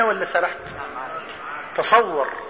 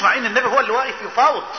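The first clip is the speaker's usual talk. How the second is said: ordinary delivery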